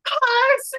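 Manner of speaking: high, loud shout of Carlos; shouting a name. Retold or imitated. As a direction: call out Carlos across a distance. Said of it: "I've had to shout".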